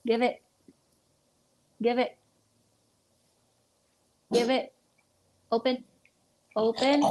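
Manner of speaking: firm pet-owner command; dog command. Real, not acted. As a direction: tell the dog to let go. Ordering dog to release bottle cap.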